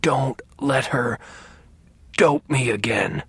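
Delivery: slow, hoarse, strained plea; hoarse but forceful. A narrator's reading. Sick father begs his son to stop the nurse, hoarse insistence. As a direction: rasp it out word by word, insisting. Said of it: "he said in a hoarse voice with surprising strength".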